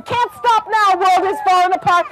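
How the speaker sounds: high-pitched urgent cry; alarmed snake. A narrator's reading. Sliding snake warning another snake, alarmed cry. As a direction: call out the warning on the move.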